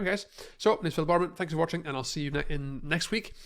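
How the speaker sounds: normal talking voice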